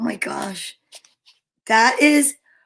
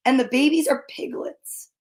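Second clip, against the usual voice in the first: ordinary delivery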